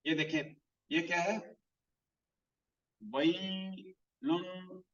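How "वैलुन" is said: वैलुन drawn out very slowly with the noon hummed through the nose; nasal ghunna. Shown as a demonstration. Tajweed teacher recites वैलुन to students, slow nasal ghunna. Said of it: "इसको हमने गुन्ना करना है".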